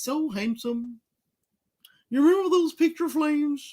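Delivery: put-on mimicry of a woman's irksome voice; doing her voice. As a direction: do your irritating impression of her. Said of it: "always have to do her voice".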